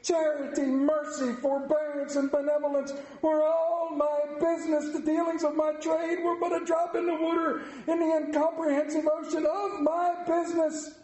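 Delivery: high, grieving phantom; lamenting ghost. Acted. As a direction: pour out the regret. Ghost laments his life.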